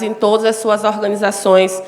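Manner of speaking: ordinary delivery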